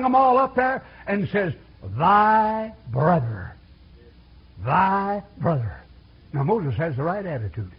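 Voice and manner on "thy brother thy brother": very slow "Thy brother", leaning on "thy"; dwelt-on phrase. Acted. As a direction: stretch out "Thy brother" and lean on "thy". Preacher savouring Moses' words.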